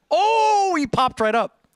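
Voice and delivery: sudden high, loud oh of discovery; real surprise. Real, not acted. Streamer finds classmate on Facebook, surprised. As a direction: caught off guard by the search result.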